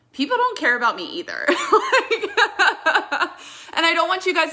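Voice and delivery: laughing at her own joke; breaking into high giggles. Real, not acted. Joke about nobody caring.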